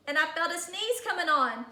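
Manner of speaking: usual speaking voice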